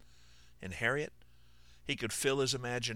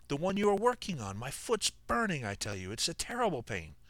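pained; 6 semitones higher than usual. Acted. Wounded man in agony.

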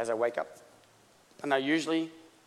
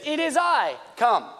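high, loud call; calling back; 10 semitones higher, 6 dB louder, slower than usual. Acted. Jesus answering Peter from the water, calling back. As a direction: project it across the storm.

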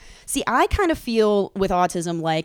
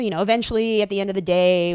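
ordinary delivery

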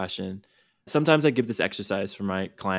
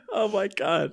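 laughing exclamation; 10 semitones higher than usual. Real, not acted. Still laughing after forgetting.

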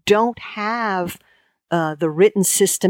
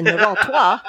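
laughing wrong example; 2 semitones higher than usual. Shown as a demonstration. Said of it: "you don't say".

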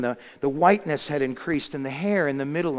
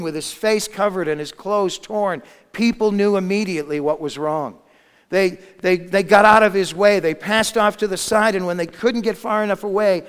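raised, driving sermon storytelling; impassioned preaching; 4 semitones higher, 6 dB louder than usual. A narrator's reading. Preacher telling how people shunned the leper, with force. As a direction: preach it louder and higher.

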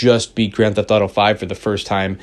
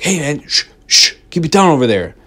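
hushing hunter; 5 semitones higher, 3 dB louder than usual. Retold or imitated. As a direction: imitate a stranger shushing you from the bushes. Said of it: "He's like".

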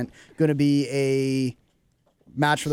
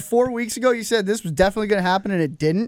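higher, louder mock taunt, stretched out on stupid; mocking quote; 6 semitones higher, 3 dB louder than usual. Host imitates listeners calling them idiots, in a mocking taunt. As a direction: imitate listeners jeering at the hosts' bad predictions. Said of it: "And be like".

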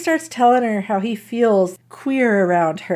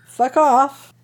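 ordinary talk, nothing marked

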